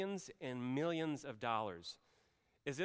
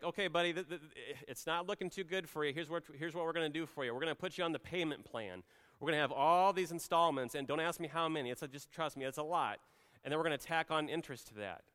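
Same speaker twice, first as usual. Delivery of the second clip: mock stern creditor; 5 semitones higher, 5 dB louder, faster than usual. Mock harsh king, contrasted.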